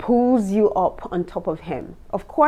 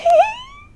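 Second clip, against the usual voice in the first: shrieking laugh; 10 dB louder than usual. Real, not acted. Bursts out laughing.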